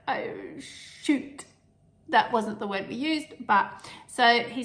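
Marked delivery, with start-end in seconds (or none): 0.1-1.4 breathy under-the-breath "Oh, shoot"; whispered curse. Retold or imitated. Retelling the heart monitor shock, whispered. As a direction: mutter it under your breath in alarm. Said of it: "It's like".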